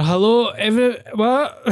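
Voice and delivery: high, cut-off greeting turning into confusion; mock stunned. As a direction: start bright then break off baffled. Comic stunned after being dumped.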